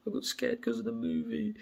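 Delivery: squeaky imitation of a frightened girl; high girlish mimic. Telling friends how his girlfriend whimpered, high mock voice. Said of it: "And she's like".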